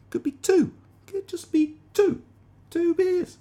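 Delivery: comic high-pitched insistence; high-pitched emphasis. Acted. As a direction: squeak it out in mock insistence. Stressing how few beers it takes.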